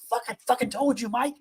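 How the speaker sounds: half-joking frantic warning to the officer; exasperated high-pitched mock outburst; mock exasperated scolding. Teller quoting himself scolding officer.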